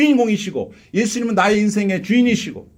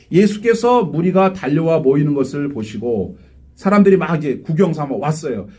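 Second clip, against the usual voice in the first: usual speaking voice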